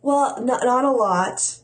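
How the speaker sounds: feigned ignorance with a sarcastic edge. Real, not acted. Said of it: "Was that sarcasm?".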